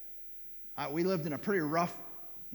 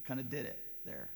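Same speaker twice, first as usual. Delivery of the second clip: normal talking voice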